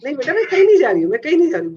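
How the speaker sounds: a hint of a fussing mother calming her kid; reassuring mother. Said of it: "कहती है". (बच्चे को जल्दी-जल्दी तसल्ली देती माँ की हल्की नकल)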